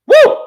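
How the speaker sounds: loud high whoop. Real, not acted. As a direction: let out a triumphant woo. Celebrating her own point.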